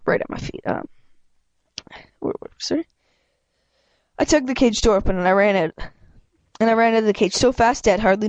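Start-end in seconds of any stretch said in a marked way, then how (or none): 0.1-2.9 whispered aside. Real, not acted. Whispered aside after losing place.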